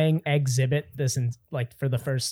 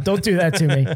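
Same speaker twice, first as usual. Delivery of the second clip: mock protest; 4 semitones higher, 6 dB louder than usual. Mock pleading with Tom.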